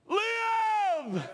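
a sudden high, loud cry of Live; shouted command. Acted. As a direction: thunder it out as God's command. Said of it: "what that little baby did".

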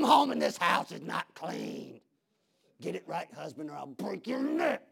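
strained rant losing its volume; hammed-up threat, easing down from the yell; mock angry husband. Husband threatening his wife, mock strained anger. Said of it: "he was yelling at his wife".

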